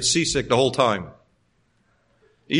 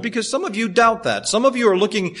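normal talking voice

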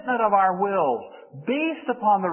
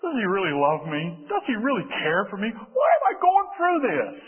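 doubting inner voice; 3 semitones lower than usual. Acted. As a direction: voice nagging doubts, rising question by question. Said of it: "kind of wonder in the back of our mind".

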